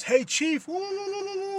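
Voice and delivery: high mock call with whooping; mocking taunt. Imitates racist passerby's taunt.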